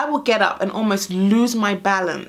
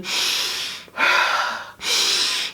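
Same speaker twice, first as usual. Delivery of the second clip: long airy exhale, reaching for air; gasping sigh. Shown as a demonstration. Mum describing needing an oxygen mask, breathless sigh. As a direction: let out a drawn-out breath as if short of air. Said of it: "I wish I had an oxygen mask".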